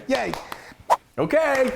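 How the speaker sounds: high, bright little squeal of joy; excited cheer. Real, not acted. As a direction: cheer with delight, laughing. Cheering the finished muffins.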